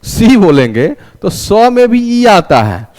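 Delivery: ordinary talk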